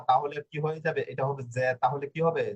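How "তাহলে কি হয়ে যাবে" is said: ordinary talk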